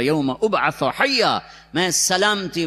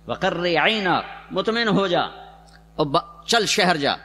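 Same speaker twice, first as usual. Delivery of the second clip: normal talking voice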